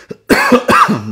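loud harsh coughing fit; hacking, choking cough. Real, not acted.

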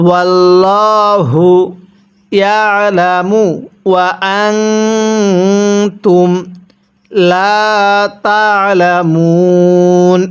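ওয়াল্লাহু ইয়ালামু ওয়া আনতুম লা তা'লামুন drawn out slowly, tajweed style; slow Quran recitation. Shown as a demonstration. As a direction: chant each word of ওয়াল্লাহু ইয়ালামু slowly with long vowels. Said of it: "এরকমভাবে শিখব". (ধীরে তাজবীদসহ তিলাওয়াত)

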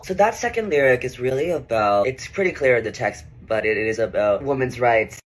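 low deadpan rapper voice; rapper character. Acted. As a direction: play a dim rapper.

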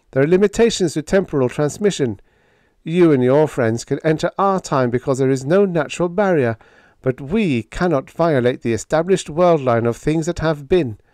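high-pitched, emphatic explaining voice; earnest lieutenant. Acted. Biggs explaining limits of time travel to Hank, earnest.

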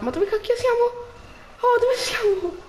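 high loud startled question; shocked bewilderment. Real, not acted. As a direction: blurt it out in shock. Teletrasportato all'improvviso. (stupore acuto e forte)

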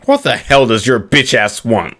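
normal talking voice

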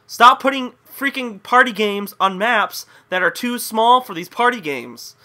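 loud, high-pitched telling-off; irritated scolding. Real, not acted. Gamer scolding developers over small maps, irritated. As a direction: scold them, pressing each point.

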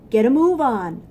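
loud barked order; a stern guard barking an order. Acted. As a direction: bark it like a guard. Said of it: "barked a stern ant".

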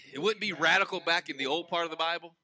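usual speaking voice

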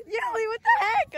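high shocked outcry. Real, not acted. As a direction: cry out in disbelief. After the hammock flip.

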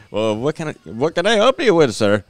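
hammed-up Indian accent. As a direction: imitate an Indian agent offering help. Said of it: "I'll give him that voice".

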